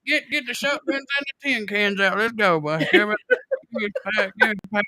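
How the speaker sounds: high-pitched Southern hillbilly holler. Acted. Joking with friends as a Southern spirit, hammed-up hillbilly accent. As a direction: put on a high, rustic Southern country voice. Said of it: "that's that Southern charm".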